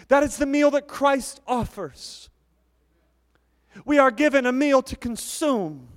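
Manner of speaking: fervent pulpit delivery; preaching. Acted. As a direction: preach it out with full force. Preaching on communion.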